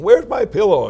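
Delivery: high, loud and thrilled; bursting with the new idea; excited exclaim. Retold or imitated. Father thrilled at night about his pillow name, excited shout.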